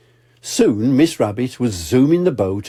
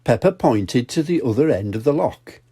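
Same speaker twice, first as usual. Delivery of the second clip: ordinary delivery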